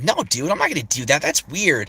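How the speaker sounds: put-on a bored teen brushing something off; early Pico voice. Shown as a demonstration. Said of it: "when I first started off doing Pico's voice".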